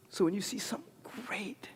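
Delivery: hushed aside to the congregation; confiding whisper. Real, not acted. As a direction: drop to a breathy secret. Sudden whisper in sermon.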